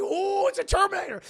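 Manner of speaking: high, loud mock-panicked cry; hammed-up high shriek of alarm. Barbara spots the Terminator coming at her, mock panic.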